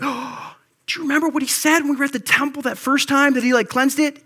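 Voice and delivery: mock gasp of the disciples; sudden realization. Disciples suddenly recall the temple, gasping excited realization.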